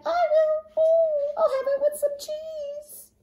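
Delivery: very high squeaky mouse. Acted. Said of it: "the little mouse said".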